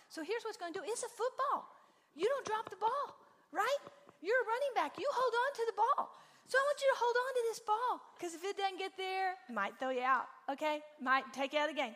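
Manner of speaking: high, loud pep-talk coach voice; mock football coach. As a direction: pump him up like a hammed-up coach on the sideline. Mom coaching son like a coach.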